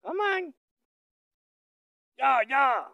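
very high, loud call to the bull. Real, not acted. Rancher calling the bull from afar, high and loud. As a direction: sing it out high to draw him over.

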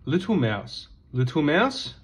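plain reading voice

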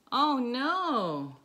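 normal talking voice

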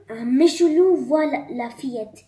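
usual speaking voice

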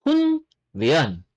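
"hunryeon" in two parts, hun, then ryeon; spelling pronunciation. Shown as a demonstration. Korean teacher reading the word for training, spelling pronunciation. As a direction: sound out "hunryeon" as written. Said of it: "Yan yung spelling".

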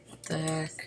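soft unvoiced aside; hushed, muttered disbelief; whispered what the heck. Real, not acted. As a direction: whisper it in irritation. Muttered frustration.

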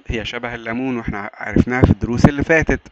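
ordinary talk, nothing marked

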